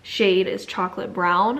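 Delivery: ordinary delivery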